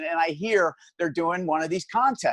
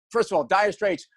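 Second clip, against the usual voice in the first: normal talking voice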